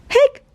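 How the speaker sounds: sharp high hiccup sound; a little squeaky hic. Acted. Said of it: "said Keeper".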